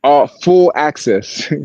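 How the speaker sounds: All full access drawn out, word by word; slow proud readout; reading it out slowly. Shown as a demonstration. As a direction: savour each word of the pass. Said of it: "This pass says".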